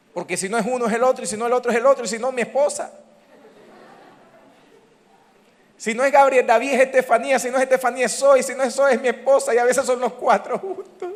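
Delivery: high, breathless-sounding run-on list; comic exasperation. Real, not acted. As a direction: pile up the interruptions, pitched high. Comic list of interrupting family.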